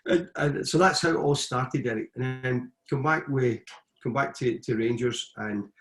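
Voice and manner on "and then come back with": normal talking voice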